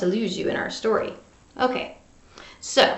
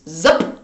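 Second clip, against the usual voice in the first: lower, louder punchy zoop; zoop sound effect; 6 semitones lower, 3 dB louder than usual. A narrator's reading.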